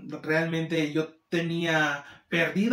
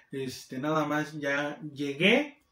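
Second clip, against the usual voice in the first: ordinary talk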